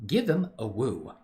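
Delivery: usual speaking voice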